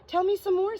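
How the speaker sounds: high, loud eager plea; excited. Acted. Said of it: "all excited".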